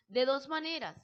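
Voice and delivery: ordinary talk, nothing marked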